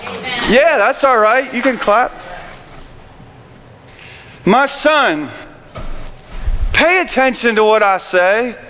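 ordinary delivery

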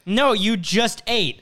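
lightly acted exasperated owner; mock firm refusal. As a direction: spell it out slowly to the dog. Owner refusing dog in story.

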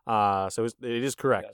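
usual speaking voice

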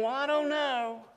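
a hint of a shrugging skeptic; unsure doubter. Pastor voicing a hesitant listener, softly doubtful. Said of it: "you're still saying".

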